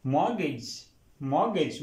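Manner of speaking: मॉर्गेज as MOR-gej with no T; silent T. Shown as a demonstration. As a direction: model मॉर्गेज in two joined beats, T left out. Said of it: "यहाँ पे टी silent होगा". (मॉर्गेज, टी silent)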